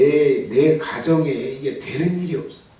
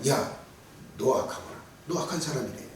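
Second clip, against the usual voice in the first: hushed judgment; 4 semitones lower, 11 dB quieter than usual. Acted. God judging an evil person.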